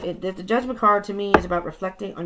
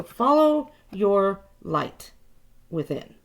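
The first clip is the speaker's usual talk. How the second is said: slow emphasis; 3 dB louder, much slower than usual. Real, not acted. Emphatic spiritual advice.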